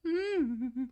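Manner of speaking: high, soft creepy voice with a hum at the end; quiet, high-pitched sinister creep; sinister Herbert. A narrator's reading. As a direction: creep them out in a high, hushed voice. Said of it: "say the most sinister thing".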